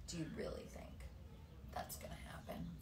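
hushed question to the listeners; whispered aside. Real, not acted. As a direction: lean in and whisper it.

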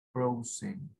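English Frozen. Shown as a demonstration. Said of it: "Frozen en inglés".

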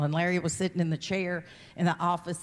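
ordinary delivery

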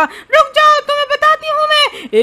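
high, loud villainess threat; menacing ghost lady. Acted. Ghost lady orders fleeing friends to stop, menacing high shout. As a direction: shriek after them as a vengeful ghost.

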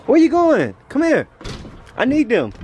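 ordinary talk, nothing marked

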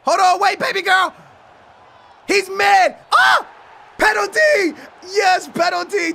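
high, loud bursts; thrilled outburst at the foul; excited shouting. Real, not acted. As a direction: yell for the penalty. Shouting as penalty is won.

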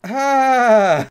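loud, high, drawn-out "ah!"; long scream. Real, not acted. Player falling in the game, long loud scream. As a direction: scream through the fall.

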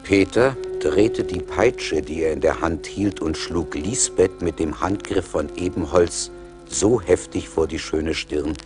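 ordinary delivery, nothing marked

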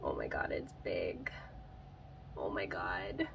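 real hushed, whispered amazement; awed whisper. Real, not acted. Plant lover unboxing a long-wanted plant, awed whisper. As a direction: whisper it, stunned at what you see.

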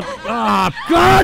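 yelping at a sound drop that cut in; startled yell. Real, not acted. Host startled by a sound drop, yelling out. Sound effect interrupts him.

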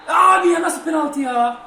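high, loud burst of protest, easing off; outraged protest. Real, not acted. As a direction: cry out at an unfair penalty call. Protests a penalty given against them.

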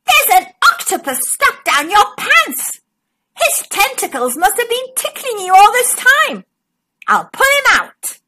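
put-on high little pirate girl; Daisy's voice. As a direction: smallest pirate helping the monster. Daisy speaking.

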